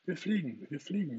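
high, gleeful hurrah; joyful cheering. A narrator's reading. Three children discover they can fly, cheering joyfully. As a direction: whoop it with delight. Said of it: "johlten alle fröhlich im Chor".

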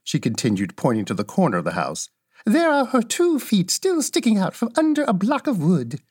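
put-on high little old woman's voice, matter-of-fact; kindly old witch. Little old woman shows Dorothy the witch's feet, cheerfully high.

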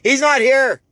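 high, loud fed-up shout; impatient shout. Retold or imitated. Imitating a fed-up patient shouting back at the hollering woman, loud and impatient. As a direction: snap back at her, out of patience. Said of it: "lost patience".

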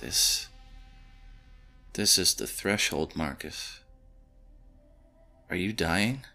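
soft, halting, nervous question; hushed and hesitant, with creeping worry. A narrator's reading. Jonah asks Marcus if he is dying, nervous. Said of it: "Jonah asked nervously".

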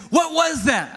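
high-pitched, astonished mock shout; excited, pitch leaping up; worked-up dad. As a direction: imitate a dad amazed and demanding an answer. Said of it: "he's very aggressive".

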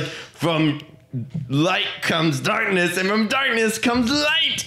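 high, slow, grand pronouncement; hammed-up epic hero. Hero declaring his magic moment, mock grand and slow. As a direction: intone it like a movie hero's big line. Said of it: "then he's like".

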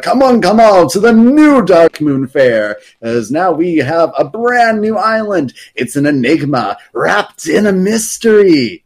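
booming fair announcer inviting everyone in; Silas Darkmoon announcing. Retold or imitated. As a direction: hawk it like a showman calling in the crowd. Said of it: "an announcement by Silas Darkmoon".

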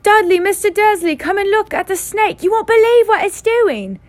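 very high, loud excited shout; boy shrieking to his father about the snake; deafening shout. A narrator's reading. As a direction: call the family over at full volume. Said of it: "A deafening shout".

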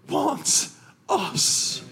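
high, choked-up words; real emotion. Real, not acted. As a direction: voice catching as emotion overtakes you. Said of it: "I'm emotional this morning".

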